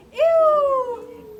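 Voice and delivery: loud, high disgusted squeal; pig squeal. Acted. Reading to child, the sprayed hog squeals loudly. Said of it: "who screamed".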